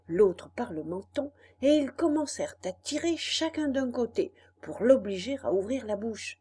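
usual speaking voice

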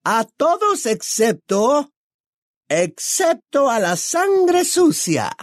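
very high, slow witch voice; menacing, drawn-out shrillness; Bellatrix. A narrator's reading. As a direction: stretch each phrase out in a high, venomous screech. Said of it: "saltó Bellatrix".

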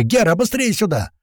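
high, urgent summoning shout. A narrator's reading. Narrator calls teammate over in battle, high yell. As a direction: yell for him to hurry. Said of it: "заорал я ещё громче".

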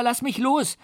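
put-on very high child's cry, falling; boy's plea. A narrator's reading. The boy repeats his plea to the monster, high and desperate. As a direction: plead like a frightened boy.

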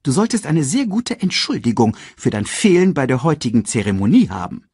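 high, fussy scolding voice; reproachful herald. A narrator's reading. Sasu scolds Scar for missing the ceremony, high reproach. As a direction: scold him in a high officious voice.

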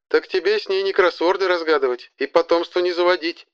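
high, mocking witch voice; sneering witch offering advice. A narrator's reading. Witch character voice, sarcastic.

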